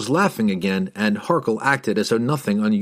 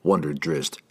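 plain reading voice

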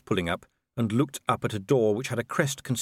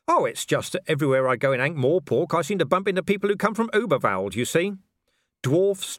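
lightly acted, higher-pitched chatty voice; needling city watchman; 9 semitones higher, 3 dB louder than usual. Watchman baits the werewolf about emigrants, lightly needling. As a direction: needle him with feigned innocence.

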